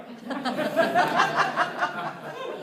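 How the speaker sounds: high, loud laugh breaking out; real laughter bubbling over; burst of laughter. Real, not acted. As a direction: crack up at the joke. Cracks up at own story.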